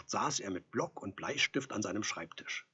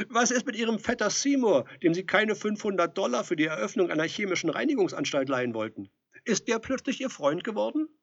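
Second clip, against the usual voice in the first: louder, higher, quick teasing devil; mocking devil; 8 semitones higher, 6 dB louder than usual. A narrator's reading. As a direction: rub his nose in it. Devil lists enemies mockingly. (lauter, spöttischer Teufel)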